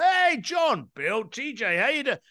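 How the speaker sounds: high, punchy call-out to buddies; hearty acted greeting. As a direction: hail old friends across the street. Acted bumping into old school buddies.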